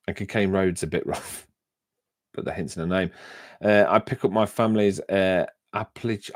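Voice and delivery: ordinary talk, nothing marked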